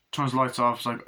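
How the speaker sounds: ordinary talk, nothing marked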